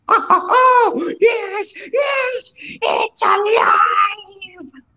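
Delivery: high, ecstatic it's alive cry; mad scientist cry. Acted. Mad baker creation scene.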